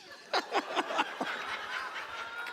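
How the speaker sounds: airy high-pitched laugh; breathy high laugh. Real, not acted. Laughing at own story.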